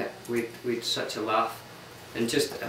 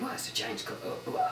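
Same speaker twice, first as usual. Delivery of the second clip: whispered; 10 dB quieter than usual. Retold or imitated. Tourists puzzled on the bus.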